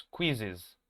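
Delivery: "quizzes" slow, kwi-ziz; drawn-out kwiz-iz; pronouncing quizzes. Shown as a demonstration. Teacher modelling the plural slowly. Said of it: "la pronunciación va a ser como se la estoy diciendo".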